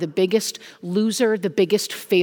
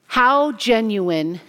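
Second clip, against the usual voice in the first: usual speaking voice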